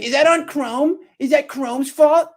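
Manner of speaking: high, whining mimicry of the helpdesk; mock her like a fake crybaby; whiny mock agent. Complaining about support questions, imitating them in a high whine.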